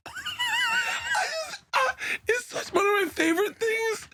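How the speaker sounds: very high squeal through tearful laughter; helpless laughter. Real, not acted. Comic retelling his favorite quote, helpless laughter. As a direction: squeeze the words out while cracking up. Still cracking up.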